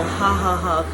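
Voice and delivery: imitated thick belly laugh, loud; big mock laugh from the belly; guttural belly laugh. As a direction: fake a hearty guttural "ha, ha, ha". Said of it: "belly, guttural".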